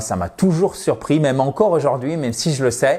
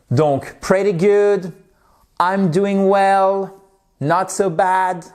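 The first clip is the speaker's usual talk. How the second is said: slow drill; 5 semitones higher, much slower than usual. Shown as a demonstration. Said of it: "drilling phrases".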